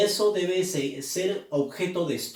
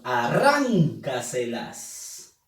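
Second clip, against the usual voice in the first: slow, stretched out syllable by syllable; drawn-out syllables; 4 semitones lower, much slower than usual. Shown as a demonstration. Teacher stretching the word out slowly for the class. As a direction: pull each syllable apart slowly. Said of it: "pronuncia, por favor".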